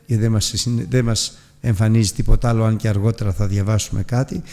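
usual speaking voice

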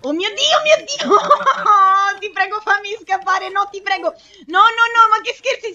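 high, loud, terrified begging; real panicked shrieks, breaking into wails; screaming in panic. Real, not acted. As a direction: scream and plead for escape. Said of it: "Nel mondo reale sto urlando".

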